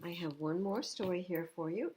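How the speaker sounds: plain reading voice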